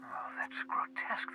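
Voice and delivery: whispered horror at the idea; hushed disgust. Acted. Scientist recoiling from the board's plan, whispered. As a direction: breathe it out, appalled.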